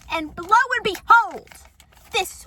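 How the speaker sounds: high, loud theatrical announcement; mock-dramatic reveal. Vlogger unveiling the note left on the door, mock-dramatic. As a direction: proclaim it grandly, pitch swooping up.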